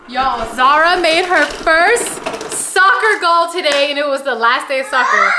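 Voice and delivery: loud, high, thrilled proud-parent shout; excited announcement. Real, not acted. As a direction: burst out with big news, stretching the words. Announcing child's first soccer goal.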